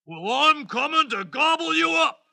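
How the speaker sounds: high-pitched, worked-up troll threat; hammed-up storybook troll roar; troll's roar. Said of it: "roared the troll".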